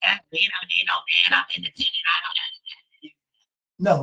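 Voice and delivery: hushed, sneering mock of a woman; mocking whisper. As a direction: whisper it with contempt.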